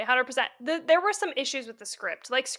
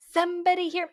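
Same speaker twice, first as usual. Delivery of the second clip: higher-pitched theatrical call; mock damsel plea; 6 semitones higher than usual. Mocking a call out when about to die, theatrical. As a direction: imitate a stagey plea for help. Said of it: "call out if you're about to die".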